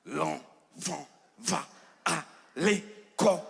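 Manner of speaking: "L'enfant va à l'école" stretched slowly, each syllable struck apart. Shown as a demonstration. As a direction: tap out every syllable slowly with the shoe. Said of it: "j'enlève ma chaussure". (découpage lent, syllabe par syllabe)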